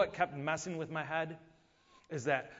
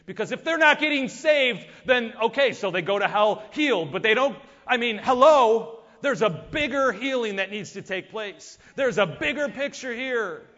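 loud, high, impassioned preaching; full-force preaching; 7 semitones higher, 11 dB louder than usual. Acted. Pastor exhorting the congregation at full force. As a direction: drive it home to the congregation.

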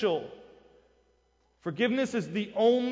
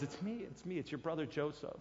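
much softer, near hushed; almost breaking as he names himself; tender reveal; 3 semitones lower, 16 dB quieter than usual. Retold or imitated. Joseph to his frightened brothers, revealing himself softly.

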